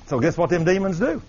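usual speaking voice

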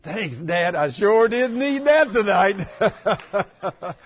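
loud, high, drawn-out relief; exultant thanks. Retold or imitated. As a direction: call up to heaven in comic gratitude. Exultant thanks to God, acted.

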